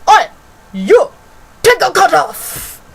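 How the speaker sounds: loud, high, drawn-out mock shout; imitated telling-off. Imitating school lady yelling across the room at pupil, scolding shout. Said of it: "suddenly looks at me and she goes".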